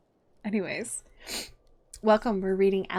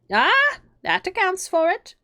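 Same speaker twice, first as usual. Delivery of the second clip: much higher, louder and slow; knowing Hatter; 12 semitones higher, 8 dB louder, slower than usual. Acted. Hatter seizing on Alice's word, high and loud. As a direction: pounce on it triumphantly.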